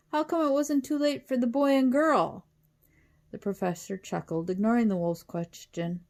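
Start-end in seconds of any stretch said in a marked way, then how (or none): 0.1-2.5 high, loud wolf complaint; wolf calling out. A narrator's reading. Wolf protests being shut out, high and loud. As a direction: call out indignantly through the door. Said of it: "called the wolf".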